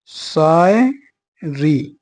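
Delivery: very slow, drawn-out "Shayari"; "Shayari" broken into long, separate syllables; spoken while writing. Real, not acted. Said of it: "लिख के देखूं कैसे लिखी जाएगी".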